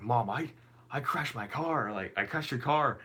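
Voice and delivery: retold fretful confession, voice lifting; freaking out. Son phoning mom about the crash, acted freak-out. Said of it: "I'm freaking out".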